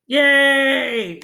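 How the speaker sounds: high, loud celebratory yay; cheer. Acted. Teacher cheering the class for the right rhyme scheme, high and loud. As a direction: cheer like a game show host.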